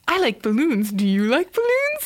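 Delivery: creepy clown, jumping high on the question; Pennywise. Retold or imitated. Clown offers the little boy balloons, creepily friendly. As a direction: lilt upward in mock-friendly clown voice. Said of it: "it's still very creepy voice".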